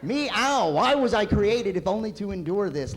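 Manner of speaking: mock anguished cat. Cat questioning its existence, hammed-up anguish. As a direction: cry out to the heavens like a doomed cat. Comic voicing of cat's lament.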